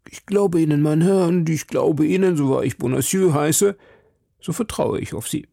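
worried citizen. Acted. Citizen confiding in D'Artagnan about the plot, lightly voiced worried citizen.

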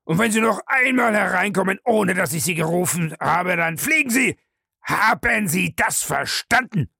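high, heated ultimatum; furious threat. A narrator's reading. Bellamy threatens to sack the butler, furious. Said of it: "brach Bellamy los".

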